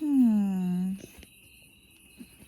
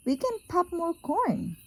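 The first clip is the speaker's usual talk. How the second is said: high mouse voice; squeaky mouse; 9 semitones higher than usual. Acted. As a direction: squeak it lightly. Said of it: "The mouse squeaks".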